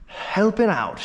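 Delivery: normal talking voice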